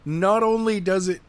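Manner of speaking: normal talking voice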